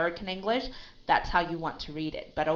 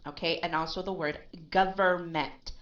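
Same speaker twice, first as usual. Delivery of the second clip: ordinary talk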